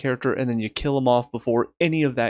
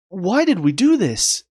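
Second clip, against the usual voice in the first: exasperated; 7 semitones higher, 4 dB louder, slower than usual. Real, not acted. Exasperated at the writers.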